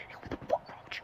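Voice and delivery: big brother's outburst imitated in a whisper; whispered mock yelling. Brother confronts the man in the bunk, whispered mock yelling. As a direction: whisper a pretend angry yell.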